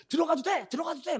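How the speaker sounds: very high, urgent acted yell; high-pitched repeated shout; shouted permission. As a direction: imitate a manager loudly waving someone through.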